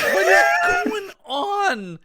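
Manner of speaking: high, slow, loud disbelief; baffled shout. Retold or imitated. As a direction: yell it in total confusion. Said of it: "You're like".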